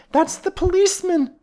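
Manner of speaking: loud, very high shrill wife. A narrator's reading. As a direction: shriek it out shrilly in alarm. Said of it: "shrilled Missus Potter".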